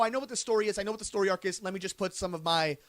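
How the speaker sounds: ordinary talk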